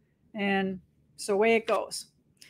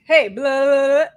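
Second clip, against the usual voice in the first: crabby text; 2 semitones higher, 7 dB louder than usual. Retold or imitated. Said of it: "Why are you so crabby?".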